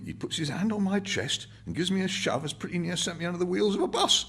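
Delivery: put-on old working-class Londoner, reminiscing; old man's voice. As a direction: play the old man, unhurried and rambling. Old man voiced in novel reading.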